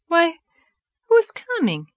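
high-pitched surprised question; startled surprise. Acted. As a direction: voice leaps up, wondering who it is. Startled by someone approaching.

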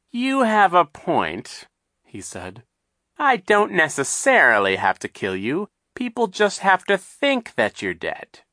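high, loud, mocking villain voice; sly villain Captain Sham. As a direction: sneer it out like a scheming captain.

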